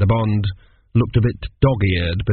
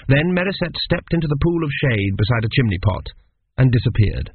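usual speaking voice

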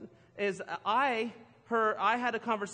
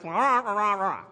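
louder wordless mock adult drone; Charlie Brown adult noise; 8 dB louder than usual. Husband mocking what he heard from his wife, droning. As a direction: blare it like the muffled grown-ups in cartoons. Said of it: "here's what it sounded like when the adults talked".

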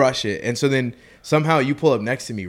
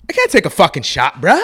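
higher, louder mock bro refusal; spot-on impression of an indignant bro; imitating the partygoer; 6 semitones higher, 6 dB louder than usual. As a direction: play the rude guy turning down the shot. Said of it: "dude, that's exactly how he sounds".

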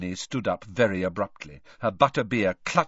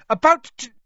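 high, loud, abruptly stopped; shout, then stop dead; shout cut short; 14 semitones higher, 11 dB louder, faster than usual. A narrator's reading. Hermione meets Dumbledore mid-rant, shout cut short.

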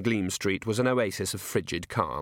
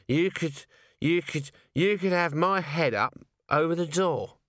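put-on drunk Nobby; 4 semitones higher than usual. A narrator's reading. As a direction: wave it about like a tipsy earl. Drunk Nobby showing his scroll.

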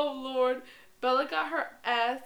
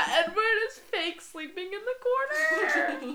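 high, squealing through laughter; slow, words dragged out by laughing; laughing hard; 9 semitones higher, 5 dB louder, slower than usual. Real, not acted. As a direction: barely get it out, cracking up. Cracking up over a funny scene.

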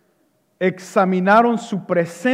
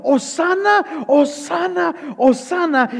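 slow, high chanted cheer, not full volume; crowd shouting; 6 semitones higher than usual. Acted. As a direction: imitate a crowd's drawn-out acclamation. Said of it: "personas gritando". (multitud aclamando, voz aguda)

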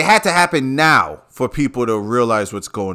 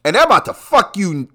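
ordinary talk, nothing marked

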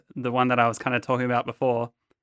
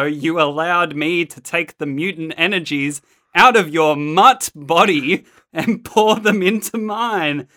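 hammed-up pterodactyl villain, building higher and louder; campy villain speech; 4 semitones higher, 5 dB louder than usual. Host reads Sauron's gloating speech to his victim, campy villain. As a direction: relish each phrase, climbing to a grand reveal. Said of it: "I'm gonna do an accent".

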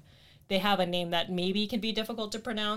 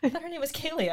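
surprised; 9 semitones higher than usual. Retold or imitated. Friends quoted, surprised by nickname.

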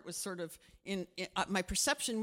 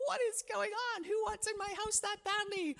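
alarmed; 13 semitones higher than usual. Retold or imitated. Retelling alarmed thought at door.

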